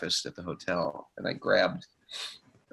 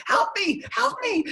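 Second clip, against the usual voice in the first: high, loud cry of help me; mock scream for help; 3 dB louder than usual. Friend imitating a drowning cry for help. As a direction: scream it like you're drowning, hammed up. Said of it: "If you've ever screamed for help".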